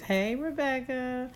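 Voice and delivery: high, slow hello to a viewer; drawn-out greeting. Real, not acted. Greeting a viewer who joined the live, high and drawn out.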